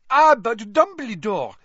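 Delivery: a loud, high objection cutting in; protesting headmistress. A narrator's reading. Maxime protests the decision.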